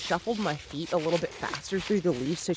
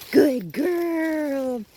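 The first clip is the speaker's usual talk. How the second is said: much higher and louder, slow praise; happy praise; 9 semitones higher, 6 dB louder than usual. Real, not acted. Trainer praising the puppy for chasing the toy. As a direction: cheer her on.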